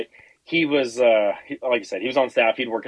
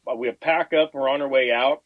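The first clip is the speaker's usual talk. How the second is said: ordinary delivery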